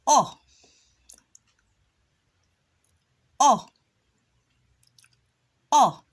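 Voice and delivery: "O" said as a short uh; short u sound. Shown as a demonstration. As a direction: model "O" loud and clear as uh. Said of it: "we can hear the O sound".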